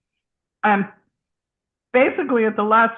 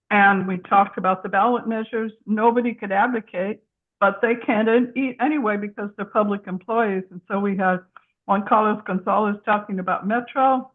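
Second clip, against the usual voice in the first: ordinary delivery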